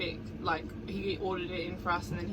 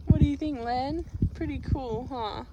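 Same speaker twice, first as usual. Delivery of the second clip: pet talk; 6 semitones higher, 5 dB louder than usual. Real, not acted. Talking sweetly to her dog.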